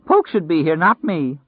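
high, loud frightened outcry; screamed protest. A narrator's reading. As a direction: cry out against the injustice. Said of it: "he screamed".

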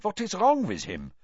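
put-on nervous foreign boy; alarmed. Krum asks Harry in alarm.

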